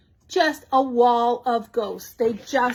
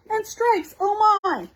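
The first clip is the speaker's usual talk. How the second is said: breathless gushing; 8 semitones higher than usual. Real, not acted. Crafter listing papers to her viewers, breathless excited gushing. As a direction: pitch jumps way up, rushing with delight.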